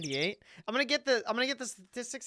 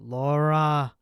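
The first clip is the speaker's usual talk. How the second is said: droning name call; 5 semitones lower, 9 dB louder, much slower than usual. Pretend. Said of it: "all it is is just me saying".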